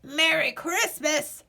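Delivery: called out. A narrator's reading. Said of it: "he called".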